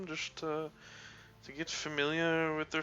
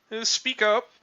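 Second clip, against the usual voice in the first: commanding; 7 semitones higher, 8 dB louder than usual. Acted. Captain orders louder voice.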